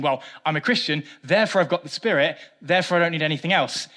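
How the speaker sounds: a hint of smug complacency. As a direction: lightly voice a self-satisfied believer. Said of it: "who are saying".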